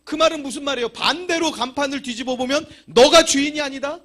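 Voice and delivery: high, loud fervent sermon; full-force preaching. Acted. Pastor warns the congregation about ownership, loud preaching. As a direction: thunder the warning at them.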